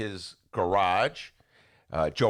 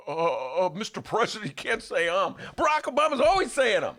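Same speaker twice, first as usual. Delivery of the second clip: higher, halting put-on critic; mock critique; 9 semitones higher than usual. Host plays critic scolding Obama, higher and halting. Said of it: "Yoda would then critique him".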